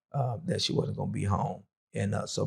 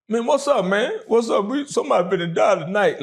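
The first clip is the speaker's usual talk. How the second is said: much higher, louder mock shout of alarm; alarmed inmates calling; 9 semitones higher, 6 dB louder than usual. The inmates seeing him cry, calling out in alarm. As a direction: call out like startled inmates. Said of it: "All the dudes ... stop".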